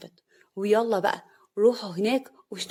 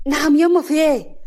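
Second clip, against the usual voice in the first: raised, high reply to a summons; loud answer; 6 semitones higher, 8 dB louder than usual. Acted. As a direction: call back, alarmed at the shouting. Son answers the call, running in.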